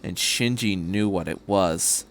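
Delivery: usual speaking voice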